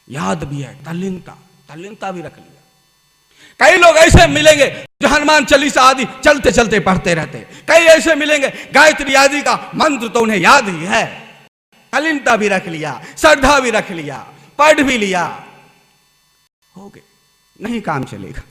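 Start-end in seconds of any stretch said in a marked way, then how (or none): 3.6-15.5 loud, driving sermon voice; fervent preaching. Acted. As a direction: preach it out to the crowd. Preacher exhorts loudly.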